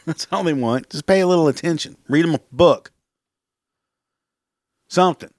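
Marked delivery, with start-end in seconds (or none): 0.0-0.9 laughing through the repeat; amused repeat with a laugh in it. Acted. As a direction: chuckle it out, amused at the kids. Same words repeated with a laugh.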